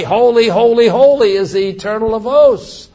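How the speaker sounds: shouted praise. Acted. Preacher voicing the seraphim, a loud high proclamation. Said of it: "The seraphim shouting this".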